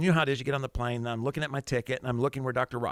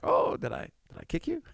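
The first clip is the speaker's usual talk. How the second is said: mock-surprised, much higher by the end; feigned innocence; 5 semitones higher than usual. Pastor to woman he deliberately kicked, feigned innocence. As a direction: act as if it was an accident. Feigns an accidental kick to passenger.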